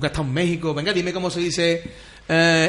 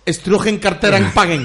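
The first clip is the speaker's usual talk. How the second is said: fake German gibberish; 2 semitones higher, 6 dB louder, slower than usual. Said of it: "en alemán".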